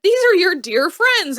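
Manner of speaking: high, drawn-out, loud insistence; pointedly chiding, slow and high; insistent reproach. Acted. Voicing Emily urging her reluctant husband.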